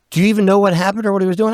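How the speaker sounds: high, rushed questioning; imitated incredulous cop. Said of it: "he goes".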